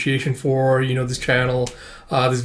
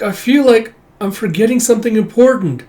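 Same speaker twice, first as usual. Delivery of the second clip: put-on high, louder child-character worry; troubled unease; 9 semitones higher, 5 dB louder than usual. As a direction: voice a girl's nagging worry, pitched up. Child voices her unease.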